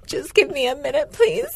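high, tearful plea with a catch; mock-sobbing request that climbs on "please"; imitating crying. Crying patient begging the dentist for a pause, imitated tearful plea. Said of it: "I start crying".

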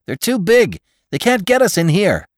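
high, panicky exclamation; high-pitched, urgent gasp. A narrator's reading. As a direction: gasp it out in alarm. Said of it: "Click's voice gasped it out".

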